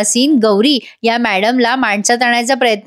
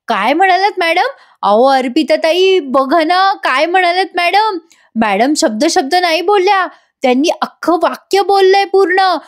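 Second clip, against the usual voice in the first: higher, thrilled and bubbling; overjoyed; 6 semitones higher than usual. Retold or imitated. Gauri thrilled that Madam spoke a full sentence. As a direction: burst with delight at the news. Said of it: "एवढी खुश होते".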